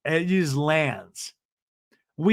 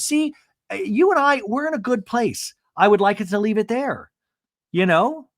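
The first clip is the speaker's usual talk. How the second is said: much higher, prim and careful; imitating Emily; 7 semitones higher than usual. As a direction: set the boundary sweetly. Emily voiced in recap.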